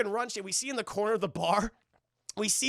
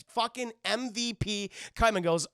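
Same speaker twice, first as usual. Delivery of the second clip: normal talking voice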